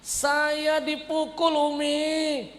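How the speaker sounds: high, loud, slow whiny fake sob; fake crying. Son fake-crying to his mother, high and loud. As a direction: whine like a crying kid.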